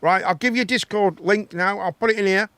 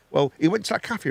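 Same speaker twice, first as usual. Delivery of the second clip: ordinary talk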